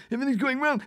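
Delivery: very high whiny fretting; whiny panicky mock. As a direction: whine it like everything is falling apart. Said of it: "and getting all pissy".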